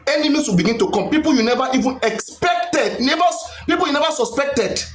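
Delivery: ordinary talk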